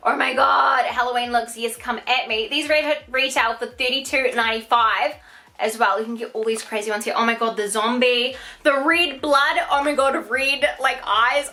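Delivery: ordinary delivery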